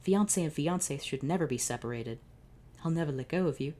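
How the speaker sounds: normal talking voice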